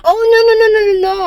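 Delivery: high, rapid-fire flustered backpedalling; acted alarm, loud and high; alarmed denial. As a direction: rush out a mock-panicked denial. Acted hasty denial of insult.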